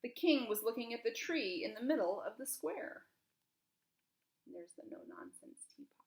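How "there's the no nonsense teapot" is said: soft breathy aside; whisper. A narrator's reading. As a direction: hush it under your breath. Hushed aside in the story.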